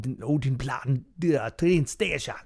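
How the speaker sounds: Odenplan said slow in a German-sounding accent; accidental German accent. Shown as a demonstration. Host mispronouncing the station so it sounds German, light mock German. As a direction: slip into a mock German lilt on the station name. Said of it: "Now it sounds German".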